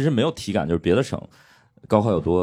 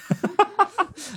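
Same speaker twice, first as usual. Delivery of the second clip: high burst of laughter; high falling laugh. Real, not acted. Host laughs at the liberal-arts jab.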